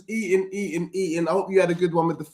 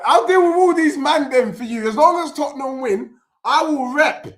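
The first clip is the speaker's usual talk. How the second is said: hyped promise; 8 semitones higher, 5 dB louder than usual. Real, not acted. Promising chat to rep club.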